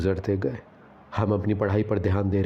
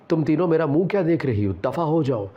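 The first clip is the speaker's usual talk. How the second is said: angry; 6 semitones higher than usual. A narrator's reading. Mother angrily sends her three daughters away. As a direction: drive them out of the room in anger. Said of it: "امی نے غصے سے کہا".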